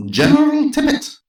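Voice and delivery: a stern Scottish lady; Scottish accent. Acted. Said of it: "with a strong Scottish accent".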